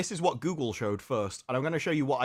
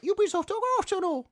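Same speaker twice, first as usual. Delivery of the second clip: squeaky, much higher mock cute Japanese girl; extra high girly voice. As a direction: pipe it up like a cute anime girl. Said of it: "'cause they go extra high".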